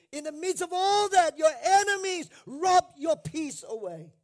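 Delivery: preacher rising to a shout against the enemy; preaching shout. Acted. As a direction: shout it out over the congregation. Preacher peaks in warning.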